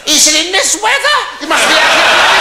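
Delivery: very high, loud comic indignation; hammed-up incredulous outburst; outraged disbelief. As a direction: shriek the punchline as if scandalised. Husband's outraged punchline reply.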